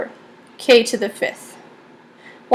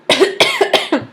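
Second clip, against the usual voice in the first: dry cough from dust in throat; a real cough, throat irritated by dust; 7 semitones higher than usual. Real, not acted. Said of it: "Got some dust in my throat".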